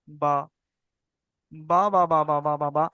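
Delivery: "বা" made from the wet inside of the lips; demonstrated, repeated lip-made "বা"; both-lip ba. Shown as a demonstration. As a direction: press both lips and release "বা". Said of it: "মানে উচ্চারণ হয় ওখান থেকে".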